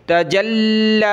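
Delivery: تجلی with the لام pressed and held a beat; doubled lam. Shown as a demonstration. Teacher demonstrating shadda on لام, lam held hard. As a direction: stop and lean on the لام in تجلی. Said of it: "لام پر سختی کر رہا ہوں، رک رہا ہوں".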